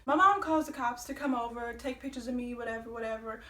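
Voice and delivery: ordinary delivery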